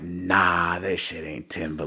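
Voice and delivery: low, soft dismissive aside; disbelieving mutter. Retold or imitated. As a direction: brush it off under your breath. Voicing his own disbelief back then.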